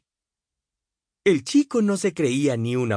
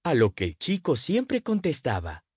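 ordinary delivery, nothing marked

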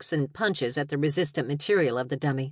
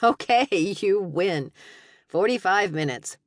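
laughing; 4 semitones higher, 3 dB louder, slower than usual. Acted. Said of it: "she burst out laughing".

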